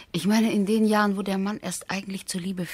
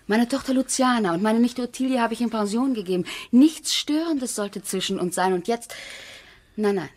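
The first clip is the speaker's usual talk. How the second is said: higher and louder, building to a pleading no; rising protest; 5 semitones higher, 5 dB louder than usual. Acted. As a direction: she defends their solitude with mounting heat. Wife protests against inviting friend. (steigender, erregter Protest)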